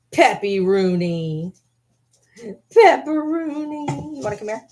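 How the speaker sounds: very high, slow sing-song nickname; drawn-out pet coo. Real, not acted. Owner croons her dog's nickname, very high and slow. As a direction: stretch the pet name out high and sweet.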